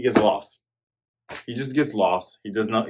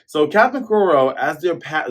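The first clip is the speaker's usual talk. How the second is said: ordinary talk, nothing marked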